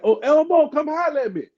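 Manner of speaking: high-pitched shout across a gym; calling across the gym. Retold or imitated. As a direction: call him over from far away. Dwayne calls across the gym.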